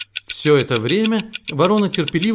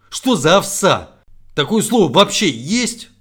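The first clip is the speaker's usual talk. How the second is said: exasperated owl; 4 semitones higher, 6 dB louder, slower than usual. A narrator's reading.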